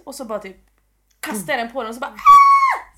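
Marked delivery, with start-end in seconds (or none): 2.1-3.0 loud, high-pitched wimpy shriek; wimpy scream. Retold or imitated. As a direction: imitate a dorky, panicked scream. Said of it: "Typ skrek lite grann som en tönt". (högt, gällt töntskrik)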